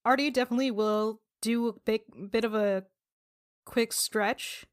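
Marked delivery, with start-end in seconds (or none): none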